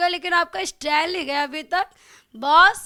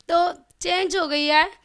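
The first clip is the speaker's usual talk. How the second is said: ordinary delivery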